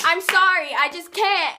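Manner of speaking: high, loud, hammed-up apology; loud apologetic refusal. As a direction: blurt it out in mock panic. Acted refusal, loud take.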